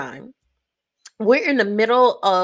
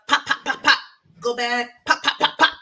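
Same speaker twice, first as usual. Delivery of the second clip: gunfire sounds. Retold or imitated. Mimics game gunfire.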